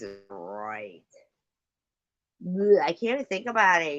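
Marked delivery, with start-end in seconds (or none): 2.5-4.0 disgusted. Real, not acted. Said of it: "I'm gonna dry heave".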